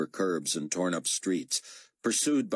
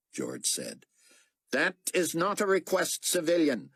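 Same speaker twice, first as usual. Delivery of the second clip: higher, clipped official radio voice; an officer issuing orders over the radio; stern radio officer; 3 dB louder than usual. Acted.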